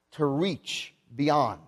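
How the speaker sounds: drawn out very slowly, falling; teaching the meaning slowly; slow definition. Shown as a demonstration. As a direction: let "to reach beyond" hang, word by word. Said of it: "it means".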